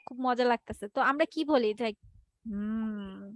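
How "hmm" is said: low, soft hum of enjoyment; savouring. Acted. Teacher imagining a delicious bite, low soft savouring. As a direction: savour it like food melting in your mouth. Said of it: "কিছু খাবার থাকে না, yam".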